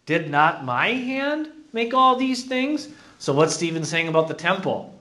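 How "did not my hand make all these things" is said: high, emphatic scripture reading; scripture read aloud. Acted. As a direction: declaim the verse in a raised pitch.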